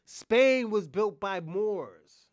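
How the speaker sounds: slow and emphatic. Real, not acted.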